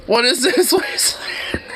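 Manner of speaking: high, breathless laughing question; laughing disbelief. Real, not acted. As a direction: lose the words to laughter. Laughing at the wasteland.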